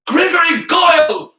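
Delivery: high, loud yell; scream. A narrator's reading. Harry interrupting the standoff, screaming. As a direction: scream the name. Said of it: "screamed Harry".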